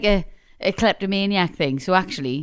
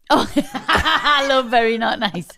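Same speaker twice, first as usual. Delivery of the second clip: delighted, drawn-out laughing reaction; 3 semitones higher, much slower than usual. Real, not acted. Mum delighted by son's odd new phrase, laughing. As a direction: laugh through the words, slowly.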